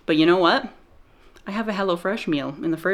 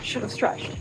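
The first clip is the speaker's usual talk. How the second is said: breathless whisper; 3 semitones higher, 6 dB quieter than usual. Real, not acted. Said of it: "Calves are killing me".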